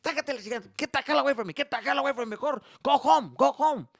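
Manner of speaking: very high, loud shouting Mexican dad; furious dad. Retold or imitated. Dad shouting his son home, very high and loud. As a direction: chase the useless helper away.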